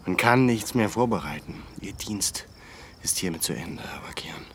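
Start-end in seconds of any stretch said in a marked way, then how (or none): 1.8-4.5 soft dismissal. Acted. Quiet dismissal.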